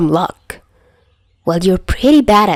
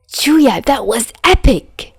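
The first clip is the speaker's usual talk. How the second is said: high, slow, delighted exclaim; thrilled; 5 semitones higher, slower than usual. Acted. Albatross thrilled after the car chase, high delighted exclaim. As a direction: gush about the ride.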